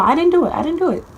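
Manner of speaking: high childlike protest, lightly voiced; child pleading. Young girl denying to her angry stepdad, high pleading voice.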